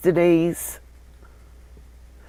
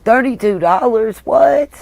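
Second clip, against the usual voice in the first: surprised; 5 semitones higher than usual. Real, not acted. Shocked at the price.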